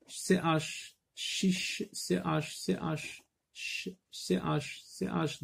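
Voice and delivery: soft, unvoiced "Chiche", then letters spelled softly; soft demo. Shown as a demonstration. Teacher softly spelling C H for the class. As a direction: spell C H softly, almost breathed.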